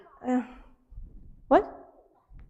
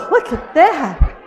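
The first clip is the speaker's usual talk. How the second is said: laughing, slow, louder praise; delighted praise; 4 semitones higher, 6 dB louder, slower than usual. Acted. Praising the puppet Robbie for helping, louder with a laugh. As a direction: gush over the balloon with a laugh.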